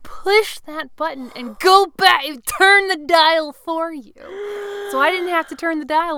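rising excitement, slowing down at the end; excited. Real, not acted. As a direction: build it up with growing delight. Excited about car preset.

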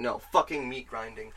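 usual speaking voice

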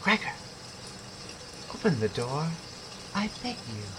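high, soft pleading whisper. A narrator's reading. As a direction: whisper a plea through the door. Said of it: "the sister whispered".

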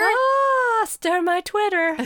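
high hammed-up sing-song; mock proclamation. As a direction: ham up the nonsense line. Mocking caption again.

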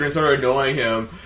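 ordinary talk

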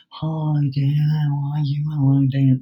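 low, droning demo of true nasality. Shown as a demonstration. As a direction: let the sound come through the nose, pitched low. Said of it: "That's nasality".

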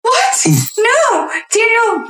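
high, shocked protest; alarmed shout. Real, not acted. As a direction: cry out in real alarm. Pet about to leap to death.

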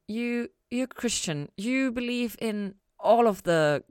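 slow, halting, searching question; slowly. A narrator's reading. Chloe slowly asking Ella about faith, drawn out and halting. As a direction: feel for each word. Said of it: "Chloe said slowly".

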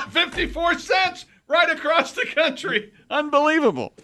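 very high, drawn-out shout; hammed-up wonder at a bargain; mock amazement. Joking about postal delivery.

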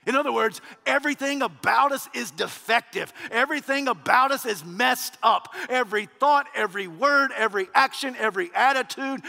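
loud, driving sermon build-up; rising pulpit fervour; fervent preaching. Acted. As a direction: preach it hard to the congregation. Preaches at full force.

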